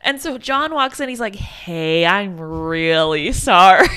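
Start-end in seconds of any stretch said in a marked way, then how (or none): 1.3-3.9 low, loud, drawn-out sorry with a laugh; mock apology. John walking in to the jailed sister, mock-apologetic. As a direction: stretch out a hammed-up apology, pitched down. Acting out John's sheepish apology.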